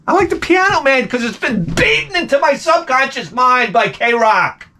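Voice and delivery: high-pitched cracking-point outburst; exasperated rant. Retold or imitated. Guest blaming radio for his taste, exasperated rant. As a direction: let fake agreement boil into ranting.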